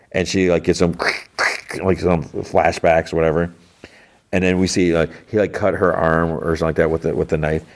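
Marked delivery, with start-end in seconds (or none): 1.0-1.8 voiceless mouth-made flashback noise; harsh whispered "kh, kh" effect; flashback sound effect. Retold or imitated. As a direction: imitate flashback cuts with short throaty bursts.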